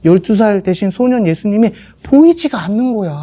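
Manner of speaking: ordinary talk, nothing marked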